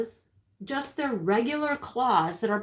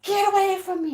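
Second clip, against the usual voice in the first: high, loud acted yell; retold yell; 8 semitones higher, 5 dB louder than usual. Woman re-enacts fending off a rooster, yelling. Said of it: "I'm yelling, I'm like".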